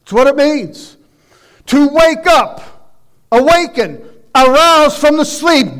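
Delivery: usual speaking voice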